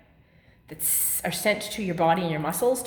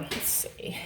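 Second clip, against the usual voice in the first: strained whisper; 12 dB quieter than usual. Real, not acted. Effortful mid-exercise mutter.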